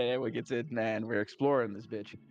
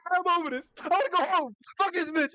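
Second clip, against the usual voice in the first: much higher and louder, fed-up whine; whiny high complaint; 5 dB louder than usual. Retold or imitated. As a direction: whine like a scared kid wanting home. Traumatized character wants to quit.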